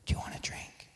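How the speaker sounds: whispered, coaxing tempter's voice; sly devil's whisper. Acted. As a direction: whisper the temptation into her ear. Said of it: "here comes the tempter".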